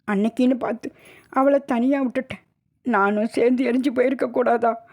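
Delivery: tearful lament. Acted. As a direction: tell it through tears, blaming yourself. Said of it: "மாரில் அடித்து கொண்டு அழுதாள்". (அழுதுகொண்டே புலம்புதல்)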